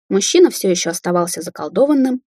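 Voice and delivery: ordinary delivery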